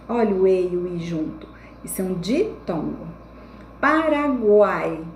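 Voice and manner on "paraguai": Paraguai stretched out slowly, guai held together; slow teacher's Paraguai; slow syllable demonstration. Shown as a demonstration. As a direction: draw out pa-ra-GUAI for the class. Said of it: "Quando você vai separar a palavrinha".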